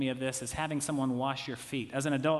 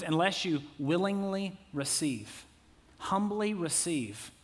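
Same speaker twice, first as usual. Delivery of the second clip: slow emphasis; 2 semitones higher, slower than usual. Real, not acted. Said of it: "So in other words".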